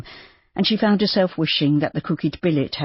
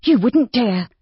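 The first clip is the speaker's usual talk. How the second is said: high, louder challenge; Judy, defiant; 7 semitones higher, 4 dB louder, slower than usual. A narrator's reading. Judy defying Rob, high and challenging. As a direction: dare him.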